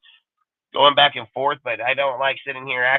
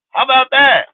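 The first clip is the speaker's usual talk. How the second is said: high, loud mocking repeat; loud sarcastic challenge; emphatic; 9 semitones higher, 5 dB louder than usual. Real, not acted. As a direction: throw it out loud and high as a dare. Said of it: "repeated louder, mocking".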